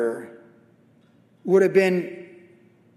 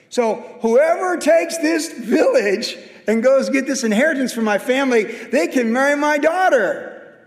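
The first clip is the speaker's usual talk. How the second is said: higher, slow, louder decree; Caleb's proclamation; 7 semitones higher, 4 dB louder than usual. Retold or imitated. Caleb announcing the prize for the city. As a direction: proclaim it like a king setting terms.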